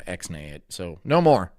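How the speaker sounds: normal talking voice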